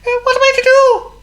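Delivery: tiny mouse wailing, very high and loud; sad squeak. A narrator's reading. Frightened John Mouse to himself as the burglar climbs in, sad squeak. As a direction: squeal it like a helpless little mouse. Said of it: "he squeaked sadly to himself".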